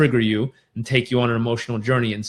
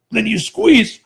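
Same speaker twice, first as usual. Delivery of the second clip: quick gasping sip of air on top, then squeeze; sharp inhale; 7 semitones higher, 4 dB louder than usual. Shown as a demonstration. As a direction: show the extra gulp of air at the top of the breath. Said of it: "And it looks like this".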